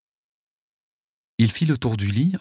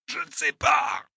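slow, very high tortured wail; piercing cry; slower than usual. A narrator's reading. Wounded attacker under interrogation, wailing in pain. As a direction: wail it out in agony. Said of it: "Il poussa un cri perçant".

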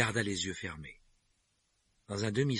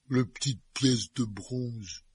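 sleepy giant; 4 semitones higher, 3 dB louder, much slower than usual. A narrator's reading. Hagrid still half-asleep.

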